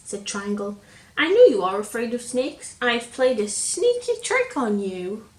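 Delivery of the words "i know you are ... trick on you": gloating. Acted. Triangle gloats.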